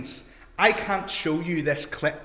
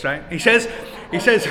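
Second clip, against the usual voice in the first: ordinary talk, nothing marked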